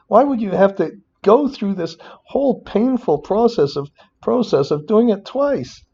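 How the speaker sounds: high, exasperated rant; emotional rant. Real, not acted. Tutor venting at modelers about duplicated debt balances, high exasperated rant. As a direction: plead in disbelief, pitch way up. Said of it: "now I'm getting all emotional".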